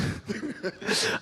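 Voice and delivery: high, breathy laugh. Real, not acted. Player teased about a cracked voice, laughing.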